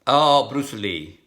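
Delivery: ordinary delivery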